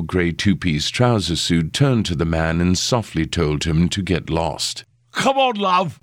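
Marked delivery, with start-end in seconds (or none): 5.1-6.0 put-on high, wheedling drunk voice; pleading drunken reporter voice. A narrator's reading. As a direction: beg like a tipsy hack. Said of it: "pleaded the reporter".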